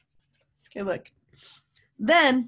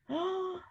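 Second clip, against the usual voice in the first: sharp gasp; 8 semitones higher, 9 dB quieter than usual. Real, not acted. Teacher reacting to an idea.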